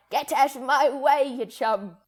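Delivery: put-on a light British accent for a cheeky dolphin; lightly voiced, snooty British dolphin. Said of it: "Like dolphins going around just like".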